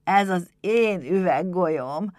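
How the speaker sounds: drawn-out wonder at a treasure; reverent. A narrator's reading. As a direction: savour each word with devotion. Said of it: "jelentette ki áhítattal".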